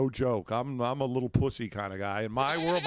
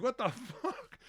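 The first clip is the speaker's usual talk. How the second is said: baffled exclamation; 9 semitones higher, 4 dB quieter, slower than usual. Retold or imitated. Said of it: "I'm like".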